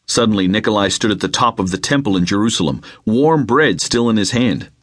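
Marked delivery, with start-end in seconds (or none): none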